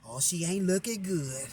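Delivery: mock the hushed doubters at the door; faithless gossip. Relatives outside the trailer, mimicked in soft hushed gossip. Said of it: "Motherfuckers talking all type of language".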